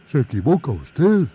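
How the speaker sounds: oso firme y sencillo; bear folk. Acted. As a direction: explica con calma que es oso. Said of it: "dijo el oso". (oso que protesta)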